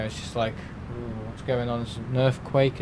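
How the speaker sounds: ordinary talk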